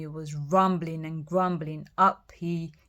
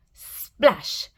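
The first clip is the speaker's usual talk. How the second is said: splash; 2 semitones higher, 6 dB louder than usual. Acted. Troll lands in river.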